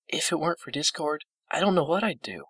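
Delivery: high, gentle character voice; gentle old pony, grateful. A narrator's reading. Fluttershy praising Discord to her friends, high and gentle. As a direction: speak up high, grateful and sweet.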